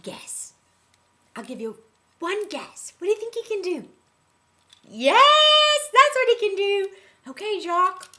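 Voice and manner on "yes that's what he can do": high, loud cry of delight; triumphant confirmation. Acted. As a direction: cheer the right guess. Celebrates audience's guess.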